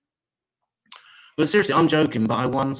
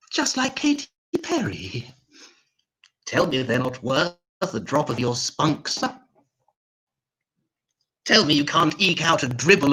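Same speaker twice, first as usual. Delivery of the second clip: sad weedy little voice; 8 semitones higher, 4 dB louder than usual. Retold or imitated. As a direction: beg like a pathetic little woman. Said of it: "her sad, weedy little voice".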